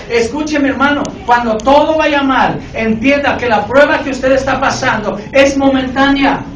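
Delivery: loud, driving sermon delivery; full-force preacher; fiery preaching. Real, not acted. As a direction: preach it hard to the congregation.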